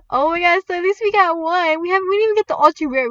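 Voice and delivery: loud, high, excited rush; thrilled. Real, not acted. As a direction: gush over a lucky win. Celebrating the legendary win.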